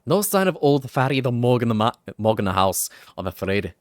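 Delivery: loud, cheeky Welsh sidekick; Welsh Drippy voice. Acted. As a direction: call out in a broad Welsh lilt.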